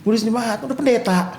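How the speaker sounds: high-pitched retold ribbing; teasing. Patient ribbing visiting friends about church duty, teasing. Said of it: "Jadi saya kayak ngeledek-ngeledek".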